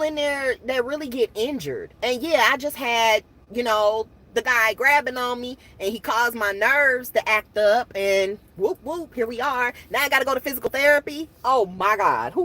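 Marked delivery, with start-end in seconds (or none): none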